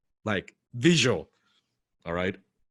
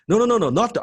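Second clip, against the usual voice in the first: loud, high rapid-fire objection; raving fan; 13 semitones higher, 7 dB louder, faster than usual. Retold or imitated. Excited fan correcting his idol, high loud protest. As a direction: burst in, overexcited. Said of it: "this guy's raving about his letters".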